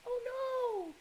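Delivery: high, alarmed oh no; sudden worry for the little one. Acted. Narrator to kids as baby gets stuck, high alarmed.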